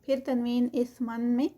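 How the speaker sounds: usual speaking voice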